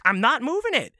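high, loud exasperated denial; frustrated protest. Retold or imitated. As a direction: imitate an indignant kid protesting loudly. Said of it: "And I'm getting frustrated".

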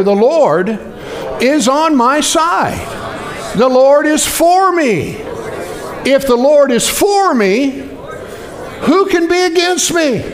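slow, ringing call-and-response; congregational declaration. Real, not acted. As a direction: lead the church in it slowly. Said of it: "Everybody say it together".

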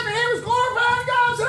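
high, loud exultant preaching; fervent pulpit holler; full-force preaching. Real, not acted. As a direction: narrate it at a full shout.

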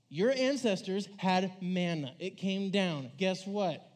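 loud, slow, exasperated proclaiming; frustrated Jesus. Retold or imitated. Jesus loses patience with the bread-hungry crowd, loud and slow. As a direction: hammer each line at a crowd that won't get it. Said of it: "It's like".

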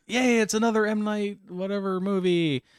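high-pitched mock enthusiasm; mock excitement. Mock cheering a new movie.

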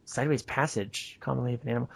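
quiet aside while puzzling over the text; reading to oneself. Real, not acted. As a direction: mull the phrase over under your breath. Re-reads the phrase to self.

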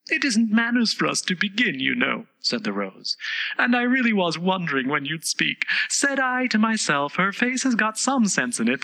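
high, fussy flower voice; a proud rose gossiping; prim rose voice. A narrator's reading. Rose's voice.

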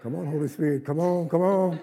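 fervent laughing call; excited call. Real, not acted. Preacher calls on the Spirit.

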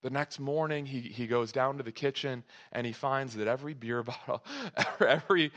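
ordinary delivery

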